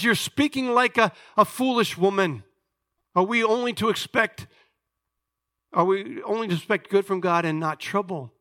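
high, loud indignant question; indignant husband, voice lifted; acted rebuke. Job rebuking his wife.

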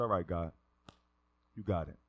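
low, soft giving in; quietly concede, voice dropped; subdued acceptance; resigned surrender. Retold or imitated. Gives in to God.

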